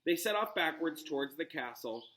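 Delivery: ordinary delivery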